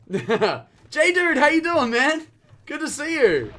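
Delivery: loud, high, delighted hail; excited greeting. Real, not acted. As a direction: call out to a pal who just turned up. Hails a viewer who just arrived.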